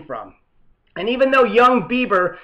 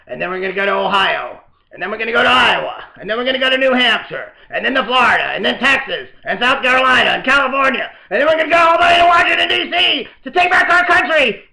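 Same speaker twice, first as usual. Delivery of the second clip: rattles off states faster and faster, climbing to a high shouted cry; Howard Dean scream speech; 3 semitones higher, 3 dB louder, faster than usual. Retold or imitated. Comic host mimics Howard Dean's rally, escalating to a frantic shout. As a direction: build like a rally speech spinning out of control. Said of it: "Howard Dean Sputnik moment".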